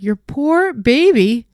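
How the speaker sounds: incredulous echo. Retold or imitated. Groomer answering the owner, slow incredulous echo. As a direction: echo it back in disbelief. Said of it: "I was like".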